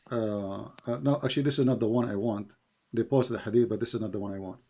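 ordinary talk

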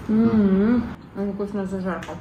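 talking between chews; while chewing. Real, not acted. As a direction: mouth partly full. Seen 1: she bit bun at -3, lips closed chewing during words.

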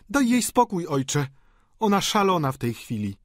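high, loud female character voice; high-pitched agitated woman; exasperated daughter. A narrator's reading. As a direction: cut in sharply on behalf of father. Daughter dismisses her frantic sister.

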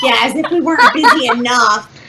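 ordinary talk

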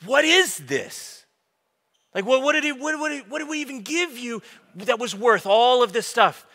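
a soldier baffled at what he died for; puzzled rebel soldier. Acted. Rebel handing over the plans, asking Leia in disbelief. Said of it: "looks at it and says".

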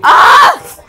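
high, loud cracked cry; cracking yelp. Real, not acted. Teen cries out with a cracking voice. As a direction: voice snaps upward. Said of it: "I get voice cracking".

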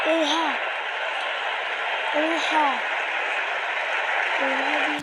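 loud stunned outbursts, one after another; shocked exclamation. Real, not acted. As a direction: blurt it out in disbelief.

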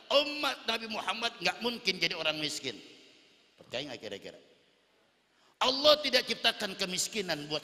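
loud, high preaching from the pulpit; fiery preaching. Acted. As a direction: preach it out at full force. Preacher raises to full force.

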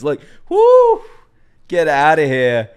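high, loud, whooping hype; hyped up. Real, not acted. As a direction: cheer the fight on. Hyped by the fight scene.

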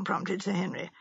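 usual speaking voice

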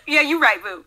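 high, loud, laughing concession; laughing agreement. Real, not acted. Conceding the pumpkin spice point to co-host, loud laughing agreement. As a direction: give in through a big laugh.

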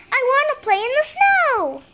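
excited. Acted. Excited frog child pleads.

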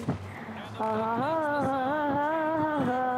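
breathless choked ha ha; gasping laugh. Pretend. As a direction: gasp out a laugh. Fake gasping laugh sounds.

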